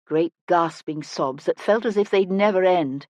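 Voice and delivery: usual speaking voice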